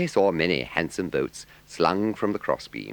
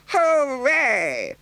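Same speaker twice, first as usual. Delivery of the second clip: much higher, louder cheer; cried hooray; 6 dB louder than usual. A narrator's reading. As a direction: burst out with a joyful shout. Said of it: "he cried".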